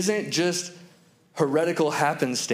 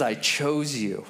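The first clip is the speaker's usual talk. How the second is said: slow, soft-spoken and lower; quiet, deliberate sincerity; tender assurance; 5 semitones lower, 3 dB quieter, slower than usual. Retold or imitated. God going back to the beginning to reassure Israel, tenderly. God's tender answer, repeated.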